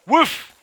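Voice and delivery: high, loud mock woof; imitated bark. Gamer barking to lure the dogs, high mock woof. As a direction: bark like a dog to call it over.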